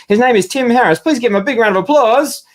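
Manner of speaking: high, loud showman build-up; big announcer intro. Real, not acted. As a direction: announce him like a game show host. Introducing the special guest.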